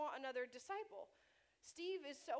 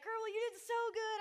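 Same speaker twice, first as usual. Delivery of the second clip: cheering; 9 semitones higher, 7 dB louder than usual. Retold or imitated. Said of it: "I was like".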